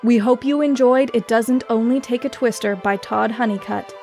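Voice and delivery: ordinary delivery, nothing marked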